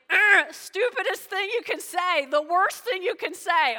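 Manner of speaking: loud, high, fed-up groan of disbelief; exasperated disgust. Retold or imitated. Preacher mocking those who are mad at God, exasperated. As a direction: scoff it like it's the dumbest thing ever. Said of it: "I'm like".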